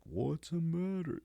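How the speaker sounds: put-on high, slow, stretched-out girl's voice. The girl asking him in slow motion, high and drawn out. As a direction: drag it out like a slowed-down tape. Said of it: "The girl gets all slow-mo".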